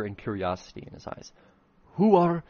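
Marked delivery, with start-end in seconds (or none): 2.0-2.4 startled question. Acted.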